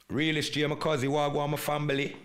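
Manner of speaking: hyped greeting to a viewer in the chat; excited shout-out. Real, not acted. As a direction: hail your cousin across the room.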